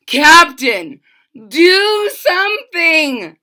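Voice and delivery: loud high urgent shout. A narrator's reading. Bartholomew bursting in on the captain, loud shout. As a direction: demand help at full voice. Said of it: "shouted Bartholomew".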